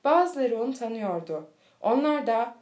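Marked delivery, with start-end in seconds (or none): none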